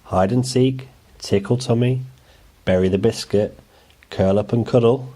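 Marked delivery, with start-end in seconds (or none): none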